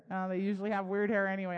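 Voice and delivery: ordinary talk, nothing marked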